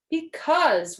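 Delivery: high, loud wail; loud, high whine. A narrator's reading. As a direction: wail it like a fretting child. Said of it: "wailed Toad".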